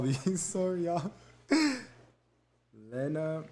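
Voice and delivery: ordinary talk, nothing marked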